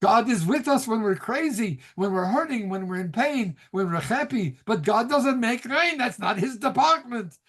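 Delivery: put-on singsong Yiddish accent, climbing high at the end; Gene Wilder's Yiddish accent. Rabbi explaining God to the medicine man, lilting Yiddish accent. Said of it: "I love his accent".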